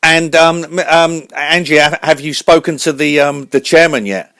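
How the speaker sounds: very high, loud cartoon host voice; radio host voice. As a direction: announce the show like a squeaky showman.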